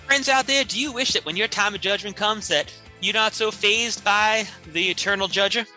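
ordinary delivery

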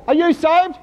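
high, loud, pointed question; evangelist challenge. Acted. Believer accosting a sinner, high loud challenge. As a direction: put it loudly to a sinner, high-pitched. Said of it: "we go up to a sinner and say".

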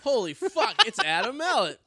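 can't believe who's at the bar; shocked. Retold or imitated. Spotting his friend at the bar, shocked shout. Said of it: "I'd be".

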